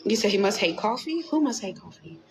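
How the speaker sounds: ordinary talk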